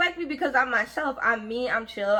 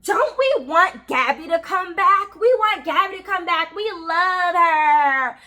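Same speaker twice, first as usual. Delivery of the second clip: put-on louder, higher, slow sing-song to the kids; hammed-up teasing coworker voice; mock taunting director; 5 semitones higher, 5 dB louder, slower than usual. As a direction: imitate her baiting with syrupy sweetness. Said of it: "then say to the kids".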